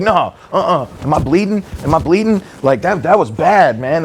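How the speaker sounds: high, alarmed voice of a frightened client; mock panic. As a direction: imitate someone sure he's been hurt. Nervous client panicking in retold scene.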